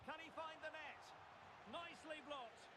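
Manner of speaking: high, tense play-by-play; excited shot call. Real, not acted. Commentator following a shot on goal, excited. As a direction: lift the pitch as the chance comes.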